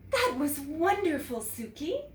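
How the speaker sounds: a teacher beaming with pride; delighted teacher. A narrator's reading. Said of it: "But Missus Paggio said".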